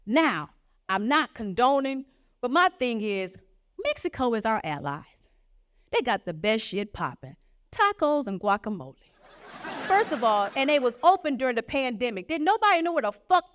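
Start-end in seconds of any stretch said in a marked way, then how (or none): none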